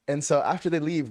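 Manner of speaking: ordinary delivery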